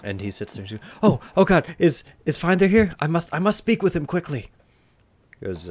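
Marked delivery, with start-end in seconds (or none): 1.0-4.5 high, loud, urgent plea; shaken diplomat. Acted. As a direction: burst in desperate to see the wizard. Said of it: "a shoddy and ill-shaken, um, diplomat".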